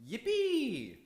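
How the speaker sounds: a sudden high cheer of joy; excited cheer. Acted. Clytemnestra cheering that her husband is coming home, squealed high and excited.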